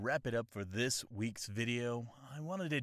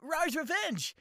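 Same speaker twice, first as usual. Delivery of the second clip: put-on anime boy voice; 3 dB louder than usual. High hyped channel intro.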